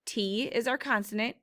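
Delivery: ordinary talk, nothing marked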